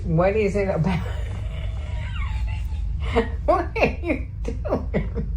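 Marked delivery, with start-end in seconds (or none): 1.0-3.4 squeaky airless laughter; high breathy giggle. Real, not acted. Laughing at the cat video. 3.5-5.3 high, slow words through laughter; laughing. Real, not acted. Laughing at the cat video.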